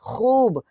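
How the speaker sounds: खूब with the oo gently stretched; slightly drawn out. Shown as a demonstration. Teacher showing learners how waw is pulled, drawn out. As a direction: hold the oo in खूब. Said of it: "हल्का खींचना पड़ता है".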